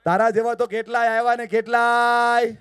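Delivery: loud, scornful shout; dismissive retort. Retold or imitated. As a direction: sneer it loudly at the speaker. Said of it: "અદબ પાડવાનો મતલબ થાય". (તિરસ્કારભરી જોરદાર બૂમ)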